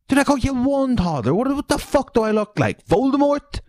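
very high, squeaky disbelief; mock incredulous outrage. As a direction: voice leaps up high in comic indignation. Mock outrage at toddler-killing poll.

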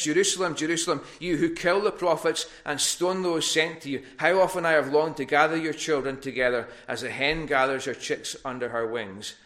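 preacher voicing Jesus weeping over the city; impassioned preached lament. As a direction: lament over Jerusalem from the pulpit.

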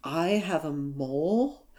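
slow, soft, baffled "I have a mole?"; puzzled question. Retold or imitated. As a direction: lean in and ask it slowly, quietly puzzled. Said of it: "he leans in and he goes".

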